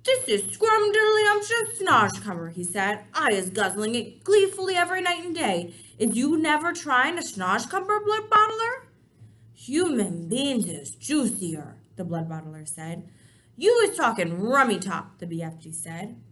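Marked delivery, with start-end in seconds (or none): 0.0-2.5 enthusiastic BFG. Acted. BFG tempts the giant. 3.1-8.9 enthusiastic BFG. Acted. BFG tempts the giant. 9.5-11.8 slow giant. Acted. Giant answers slowly. 13.6-15.2 lively BFG. Acted. BFG argues.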